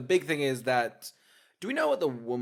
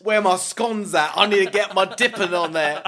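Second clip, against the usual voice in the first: mock British; 3 semitones higher, 8 dB louder than usual. Continuing British girl parody.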